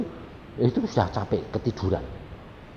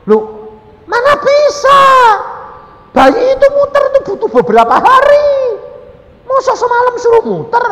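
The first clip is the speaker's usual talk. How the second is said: much higher, loud scoffing voice; loud high disbelieving husband; incredulous husband; 13 dB louder than usual. Retold or imitated. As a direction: scoff that babies can't turn overnight. Husband doubting overnight turning.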